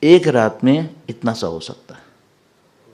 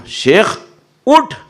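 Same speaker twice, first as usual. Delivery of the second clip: a mildly raised, gruff mock call to get up; angry wake-up call; 10 semitones higher, 3 dB louder, much slower than usual. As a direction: imitate an irritated waker, a bit louder. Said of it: "آپ بڑے غصے میں ہیں".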